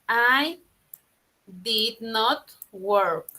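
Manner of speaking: very slow, every word held apart; slow model sentence. Shown as a demonstration. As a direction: dictate it word by word. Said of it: "La oración es así".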